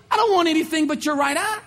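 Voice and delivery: lightly voiced tyrant laying down terms; enemy's demand.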